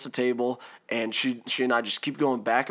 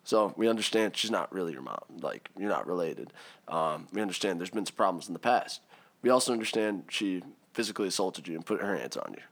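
lower, softer, measured official voice; calm police officer; 5 semitones lower, 5 dB quieter than usual. Retold or imitated. Cops pulling the kid aside, calm and official. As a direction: lay out the facts like a cop.

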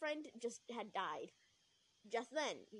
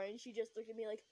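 plain reading voice

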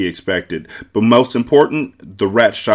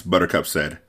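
ordinary delivery, nothing marked

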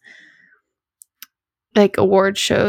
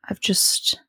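whisper; 7 dB quieter than usual. Real, not acted. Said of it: "been tired".

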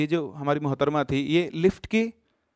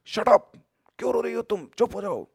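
angry shout; 7 semitones higher than usual. Retold or imitated. Girl yells at wailing ghost.

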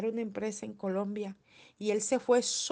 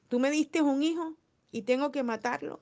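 a hint of Abraham, higher and louder, pleading; Abraham's plea; 6 semitones higher, 5 dB louder than usual. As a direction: lightly voice Abraham's anguished plea to God. Abraham speaking to God. (súplica leve de Abraham, más aguda y fuerte)

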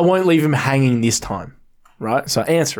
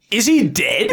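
retold alarmed question; 5 semitones higher, slower than usual. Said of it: "And I was like".

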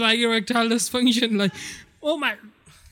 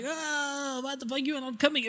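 mock-crying, softer and higher, rushed plea; whiny Bruce Banner; 4 semitones higher, 8 dB quieter than usual. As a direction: whimper it like a pleading boyfriend. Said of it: "The Bruce Banner was like".

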